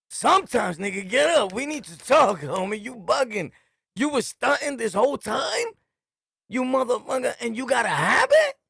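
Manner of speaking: calling out a friend caught using; shocked confrontation. Retold or imitated.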